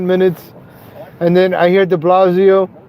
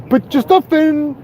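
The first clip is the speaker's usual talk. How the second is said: higher mock of the mayor's order; a touch of a dopey voice, pitched higher; lightly voiced parody of de Blasio's advice; 8 semitones higher than usual. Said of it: "de Blasio sounds like that idiot".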